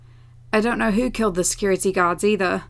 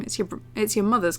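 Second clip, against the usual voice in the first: usual speaking voice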